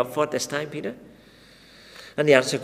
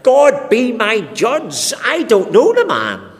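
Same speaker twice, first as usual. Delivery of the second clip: loud, higher, slow and solemn; swearing an oath; 11 semitones higher, 9 dB louder, slower than usual. Acted. Peter swearing before God that he never knew Jesus, loud and solemn. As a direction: call God down as witness, defiant. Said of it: "He's saying something like".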